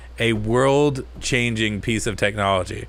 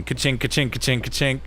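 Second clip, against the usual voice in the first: cash register noise; 3 dB quieter than usual. Retold or imitated.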